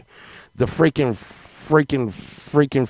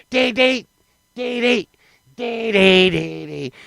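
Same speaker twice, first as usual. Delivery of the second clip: high "dee dee" sliding into a slow tail; strong man noise; 5 semitones higher, slower than usual. Retold or imitated. Strong man starting all over, high squealed noise. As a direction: chirp high, then draw it out.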